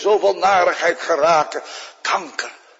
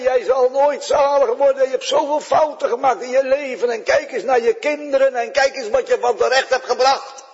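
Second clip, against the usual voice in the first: licht gespeelde beschuldigende duivel, hoger; duivel die aanklaagt; 7 semitones higher, faster than usual. Retold or imitated. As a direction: een vleugje verwijtende duivel. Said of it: "hij kwam en hij zei". (de duivel klaagt de zieke vrouw aan: licht gespeeld, hoger)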